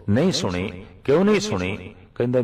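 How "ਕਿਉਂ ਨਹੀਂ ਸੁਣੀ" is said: higher-pitched, acted demand for a reason; challenging question. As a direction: question the listener who missed it, pitch jumping up. Acted speaker questions the inattentive listener.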